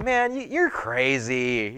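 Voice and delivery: slow, drawn-out acted brush-off; dismissive disbelief. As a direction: wave her off slowly, as if humoring her. Acts the doubters brushing her off.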